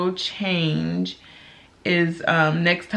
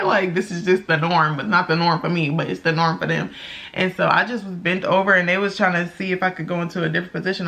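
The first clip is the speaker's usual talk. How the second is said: normal talking voice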